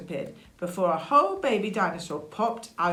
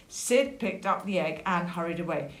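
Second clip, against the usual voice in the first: ordinary delivery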